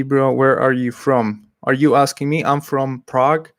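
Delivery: usual speaking voice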